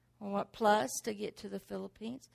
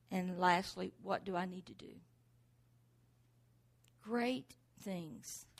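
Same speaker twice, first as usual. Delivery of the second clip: usual speaking voice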